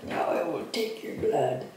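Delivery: low, quiet vampire threat; spooky vampire voice. Pretend. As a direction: play a creepy vampire, hushed. Playful spooky line after brushing.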